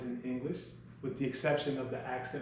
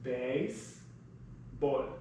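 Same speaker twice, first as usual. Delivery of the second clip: Baseball stretched very slowly; sounding it out; 3 dB quieter, much slower than usual. Shown as a demonstration. Teacher sounds out Baseball very slowly for class. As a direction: drag Baseball out syllable by syllable. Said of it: "let's sound it out".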